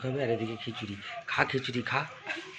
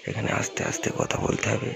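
whisper; 3 dB quieter than usual. Real, not acted. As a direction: breathe it out without voice.

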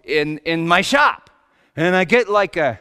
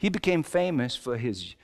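normal talking voice

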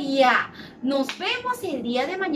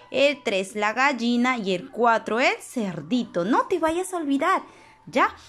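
ordinary delivery

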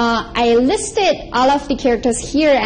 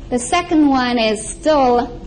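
ordinary talk, nothing marked

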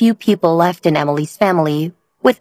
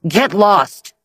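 snarled; 3 semitones higher than usual. A narrator's reading. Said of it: "Logan snarled".

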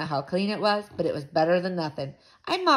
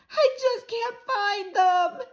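high, slow whine; upset wail; 14 semitones higher, slower than usual. A narrator's reading. Girl searching the ground in vain, wailing. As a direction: draw it out in a tearful high voice.

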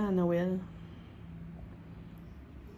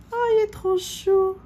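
cooing; 11 semitones higher, 4 dB louder than usual. Real, not acted. Cooing over a tiny gift.

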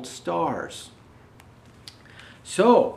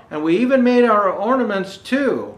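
a touch louder, higher cheer; excited children's shout; 6 semitones higher, 7 dB louder than usual. Acted. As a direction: proudly call out to the parents. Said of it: "the children shout".

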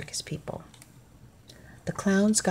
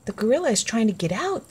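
higher, quick cry of warning; mild alarm; 6 semitones higher, 4 dB louder, faster than usual. A narrator's reading. People warn that the gorilla is escaping, mildly alarmed. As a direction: read the crowd's alarm with a fast, lifted voice. Frightened crowd flees from the jumping gorilla.

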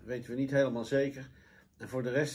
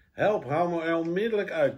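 high, loud cry for help; furious roar; 4 semitones higher, 5 dB louder than usual. A narrator's reading. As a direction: roar it as an angry demand. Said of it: "brulde hij woedend". (woedend brullen)

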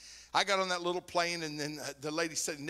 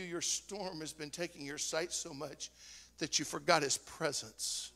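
normal talking voice

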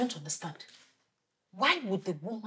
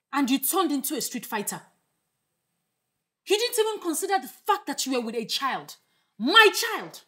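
rising indignation, higher as it builds; indignant scolding; 6 semitones higher, 5 dB louder than usual. Acted. Mother scolding sister over her child, indignant. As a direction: scold hard, building to an outraged peak.

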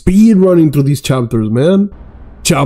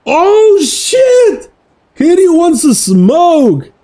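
shocked yell; 11 semitones higher, much slower than usual. Real, not acted. Huge surprise.

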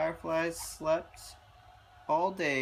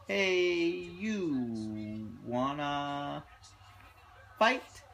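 grouchy ladybug; much slower than usual. Acted. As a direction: stretch it out then punch fight. Drawn-out challenge to whale.

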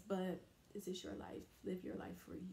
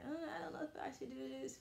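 doubtful inner voice; 7 semitones higher, 6 dB quieter, faster than usual. Retold or imitated. Voicing her doubting self.

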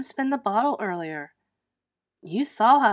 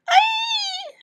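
high sharp scream of fright; startled shriek; 6 dB louder than usual. Acted. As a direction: shriek as if a spider landed on you. Girl startled by spider.

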